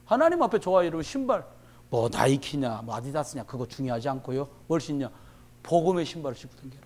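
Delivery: ordinary talk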